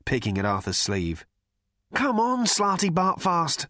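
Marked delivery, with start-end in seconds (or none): 1.9-3.7 high, anxious urging; raised pitch, slowing on the name; nervous. A narrator's reading. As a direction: hurry someone along nervously. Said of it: "said Ford nervously".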